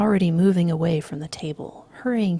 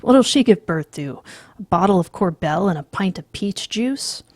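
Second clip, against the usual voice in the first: sneering; 8 dB louder than usual. Acted. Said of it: "Price sneers".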